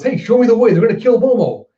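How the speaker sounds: high, loud urgent plea; urgent shout. Retold or imitated. Luffy urgently shouting to Yamato to save Momo. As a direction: shout for help to save a friend. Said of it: "Luffy goes".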